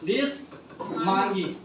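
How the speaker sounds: विसमांगी drawn out slowly in two parts, विस, long pause, मांगी; word broken apart. Shown as a demonstration. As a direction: split विसमांगी slowly and softly, pausing after विस. Teacher splits the term for class. (विसमांगी को धीरे-धीरे, विस और मांगी में तोड़कर)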